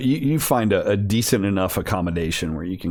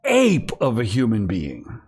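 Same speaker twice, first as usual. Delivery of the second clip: ordinary talk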